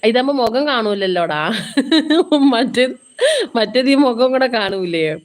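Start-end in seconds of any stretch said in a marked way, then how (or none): 1.4-2.5 a short real laugh, a bit higher. Real, not acted. Host laughing at her own remark to viewers, short laugh. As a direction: laugh briefly at your own joke.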